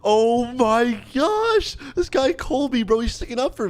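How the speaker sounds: high-pitched, stretched-out excitement; thrilled amazement. Real, not acted. Streamer sees Colby sticking up for him, thrilled. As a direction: gush in high-pitched disbelief.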